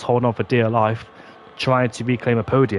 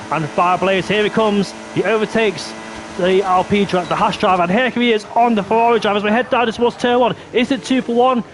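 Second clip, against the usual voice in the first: excited commentary; 8 semitones higher than usual. Real, not acted. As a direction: call the overtakes with rising excitement. Live call, multiple overtakes.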